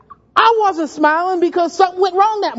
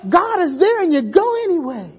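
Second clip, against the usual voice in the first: normal talking voice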